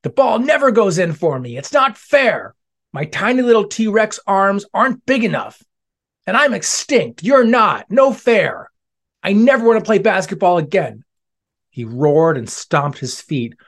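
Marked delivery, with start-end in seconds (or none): 0.0-1.6 high, loud, whiny outburst; angry tantrum. Acted. Dinosaur tantrum after missing. 1.6-2.5 high, loud complaining cry; angry whine. Acted. Dinosaur tantrum after missing. 2.9-11.0 high, loud, whiny outburst; angry tantrum. Acted. Dinosaur tantrum after missing.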